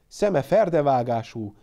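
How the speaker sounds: ordinary delivery, nothing marked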